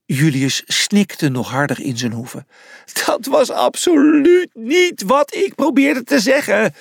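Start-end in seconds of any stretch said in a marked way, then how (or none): 3.1-6.7 high, anguished lament; wailing. A narrator's reading. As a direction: moan it out, pitched way up. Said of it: "jammerde hij".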